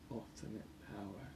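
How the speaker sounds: hushed secret whisper. Acted. Character whispers secret plan.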